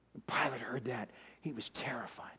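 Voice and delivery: near-whispered, low narration; hushed dread. A narrator's reading. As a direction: drop to a hush for the fearful moment. Hushed narration of Pilate's fear.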